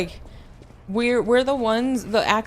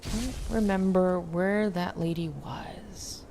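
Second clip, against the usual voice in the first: whisper; 7 dB quieter, slower than usual. Real, not acted. Unvoiced, searching quietly in game.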